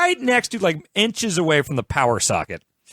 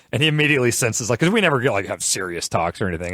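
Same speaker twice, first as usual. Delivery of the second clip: ordinary talk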